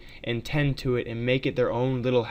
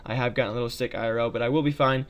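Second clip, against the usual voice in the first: ordinary talk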